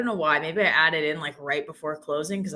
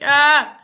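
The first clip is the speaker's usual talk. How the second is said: sudden loud, high "ah"; mock yell; 9 semitones higher, 13 dB louder than usual. Streamer acting out how she'd be, loud mock yell. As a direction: burst out with a high mock scream. Mock outburst after accent attempt.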